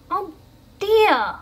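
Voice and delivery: high, loud, cheery dismay; bright voice. A narrator's reading. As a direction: chirp it out brightly. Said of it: "came a bright voice".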